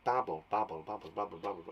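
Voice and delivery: low, soft, repeated babble; soft, rolling babble; bubbling water. Shown as a demonstration. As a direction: let the words burble like a stream. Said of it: "Sounds like bubble, doesn't it?".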